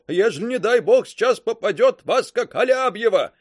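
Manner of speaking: high, loud fearful shout; yelling. A narrator's reading. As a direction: keep yelling the warning. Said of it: "снова заорал Каблуков".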